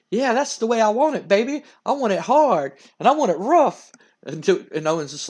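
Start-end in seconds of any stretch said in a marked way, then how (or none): 0.1-3.9 higher, swooping suggestive voice; over-the-top wrestler. Acted. Wrestler egging on his tag partner, eager and suggestive. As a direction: ham up the eagerness, pitch raised. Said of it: "And Doolove's just like".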